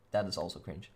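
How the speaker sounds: ordinary talk, nothing marked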